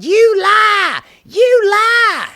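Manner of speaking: high, loud heckler's yell; a jeering hammed-up heckle; mock heckling shout. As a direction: shout down the president. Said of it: "screaming and yelling at him, going".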